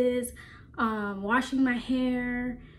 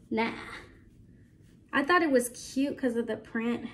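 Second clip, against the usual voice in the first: ordinary delivery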